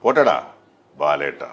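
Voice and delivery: loud first word, then a low drop on the name; theatrical film villain sign-off; Riyas Khan. Shown as a demonstration. Dubbing artist performs his famous catchphrase, in dramatic character voice. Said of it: "അത് റിയാസ് ഖാന് വേണ്ടി ചെയ്ത".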